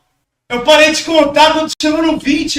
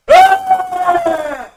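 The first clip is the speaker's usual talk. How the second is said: straining yell. Real, not acted. Straining mid-push-up.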